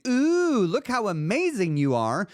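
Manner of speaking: mock gushing praise. Imitating flatterers.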